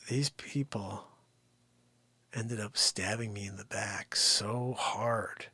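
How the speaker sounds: hushed, breathy confession; whisper. Real, not acted. Realtor confiding a horror story to viewers, whispered. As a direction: lean in and whisper it.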